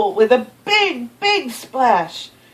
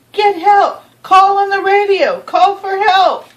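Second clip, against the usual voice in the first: high, loud and pressing; urgent plea; 9 semitones higher, 5 dB louder than usual. Acted. A boy urging the policeman to radio for help, high and loud. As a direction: hurry them to call for help.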